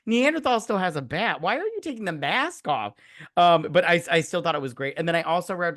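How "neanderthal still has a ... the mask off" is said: pitched-up exasperated question; mock exasperation. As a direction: play the incredulous viewer, voice raised high. Retelling own reaction to scene.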